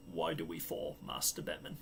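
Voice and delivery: low, soft butler voice like Michael Caine. Retold or imitated. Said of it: "as Michael Caine".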